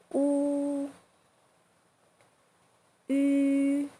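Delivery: A, o, o, e, u, u each high, flat and even; A, o, o, e, u, u sustained with no rise or fall. Shown as a demonstration. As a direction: model A, o, o, e, u, u as long level high notes. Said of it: "Keep your voice flat and even".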